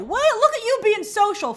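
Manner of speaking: high pitched surprise; surprised. Real, not acted. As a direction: pop up high in mock surprise. Surprised at chess night.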